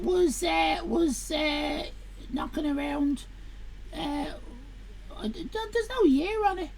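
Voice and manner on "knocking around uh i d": ordinary talk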